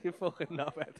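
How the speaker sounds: usual speaking voice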